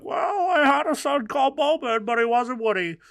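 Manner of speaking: high, loud mock-mother voice; imagined mother. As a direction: put on a high voice for a disowning mother. Acts the disappointed mother.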